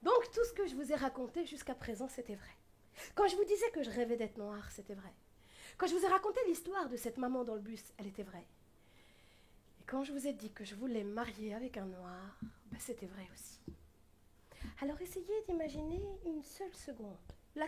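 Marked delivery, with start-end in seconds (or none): 2.1-2.6 soft, quiet and sincere; lowered voice, earnest admission; sincere murmur. Real, not acted. Sincere confession to audience. 4.4-5.1 soft, quiet and sincere; lowered voice, earnest admission; sincere murmur. Real, not acted. Sincere confession to audience. 8.0-13.6 soft, ending in a whisper; hushed sincere admission; quiet confession. Real, not acted.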